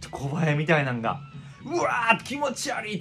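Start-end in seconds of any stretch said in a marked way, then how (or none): 1.6-3.0 high, drawn-out grossed-out groan; disgusted outburst. Retold or imitated. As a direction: recoil in disgust. Bugs swarming from jeans.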